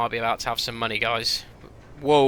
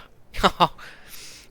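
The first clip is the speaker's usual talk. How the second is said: laugh; 7 semitones higher than usual. Real, not acted. Disbelief at deal.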